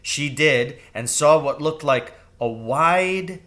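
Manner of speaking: plain reading voice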